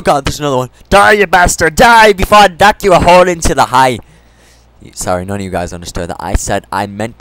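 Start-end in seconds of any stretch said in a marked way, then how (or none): 0.9-4.0 high, loud hammed-up threat; loud battle yell. Real, not acted. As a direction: roar at the zombie. Attacking another zombie.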